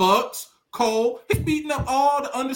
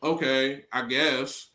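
mock sarcasm; 7 semitones lower, 4 dB quieter than usual. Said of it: "I'm like".

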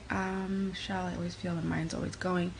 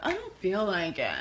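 mock reluctance. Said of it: "the doing us is, like, the".

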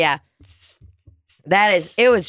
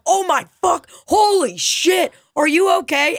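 high, stretched-out mock alarm; shocked passerby; 10 semitones higher than usual. Woman running up behind a fallen stranger, shocked. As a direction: imitate a horrified woman rushing over. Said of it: "starts running up and is like".

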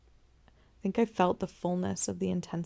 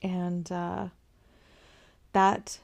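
ordinary talk

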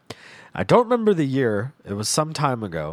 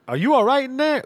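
high, louder mock-worried call; imitating a worried robber; concerned; 6 dB louder than usual. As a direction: call through a door to check on someone. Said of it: "he was concerned".

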